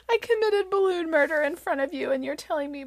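choked with guilt; guilty and teary. Retold or imitated. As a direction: mom reacting to toddler, lightly teary. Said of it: "now I feel terrible".

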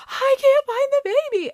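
imitating a panicked squeal, very high; frantic. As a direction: wail it as the panicking husband. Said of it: "and he's frantic".

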